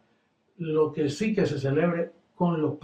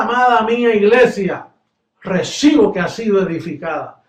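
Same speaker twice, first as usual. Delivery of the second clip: loud, rising preacher's call; fervent sermon delivery, louder; preaching at full force; 4 semitones higher, 8 dB louder than usual. Acted. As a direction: preach it out to the congregation. Preaching to the church.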